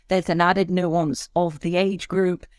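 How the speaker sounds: usual speaking voice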